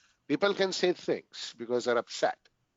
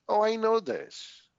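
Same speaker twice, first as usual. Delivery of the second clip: dismissive retort; 7 semitones higher than usual. Retold or imitated. Retelling his first counseling session to the counselor, a mock dismissive scoff. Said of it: "I said that the first meeting".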